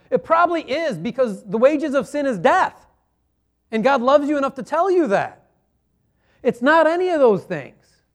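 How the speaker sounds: ordinary delivery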